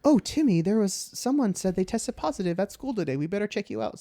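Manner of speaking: high, sweet mom voice. Retold or imitated. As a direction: play the caring mother. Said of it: "She's like".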